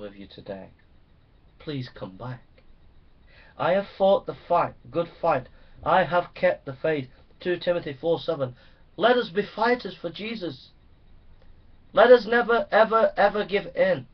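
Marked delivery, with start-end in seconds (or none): 3.6-14.1 much higher, much louder preaching; fiery sermon climax; preaching at full force. Acted. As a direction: thunder it from the pulpit.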